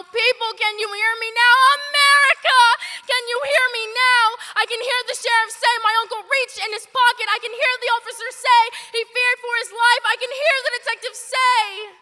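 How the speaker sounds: very loud and high, fast on the last lines; shouted appeal. Acted. Calling on America to hear her, a shouted appeal. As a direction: cry out to the whole country.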